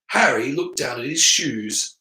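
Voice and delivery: ordinary delivery, nothing marked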